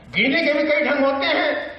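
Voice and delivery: high, declaimed line of verse; Bhavani Prasad Mishra. Acted. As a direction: lift the line up high for the audience. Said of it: "बातचीत की शैली".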